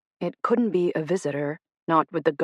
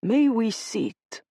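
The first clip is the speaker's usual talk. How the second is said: put-on Italian accent; 5 semitones higher, much slower than usual. A narrator's reading. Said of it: "his Italian accent thick".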